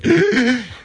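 high, strained wheezing gasp; imitated wheeze. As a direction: mimic having the wind knocked out of you. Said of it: "I'm sitting there wheezing".

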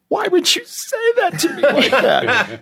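high squeaky hurt voice with a laugh; husband mimicking his wife's offended reaction, squeaky; mock offended wife. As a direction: parody the wounded spouse.

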